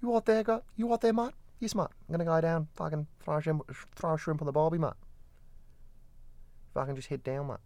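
high-pitched hammed-up Australian; Australian accent. Shown as a demonstration. Demonstrating Australian to co-host, high caricature Australian accent. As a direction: push a broad Aussie twang right up high. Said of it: "change my accent into Australian".

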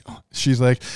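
normal talking voice

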